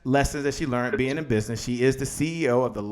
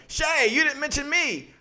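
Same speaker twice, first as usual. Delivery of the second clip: higher-pitched mock complaint; complaining viewer; 10 semitones higher, 4 dB quieter than usual. Viewer whining to host about being left out, mock complaint.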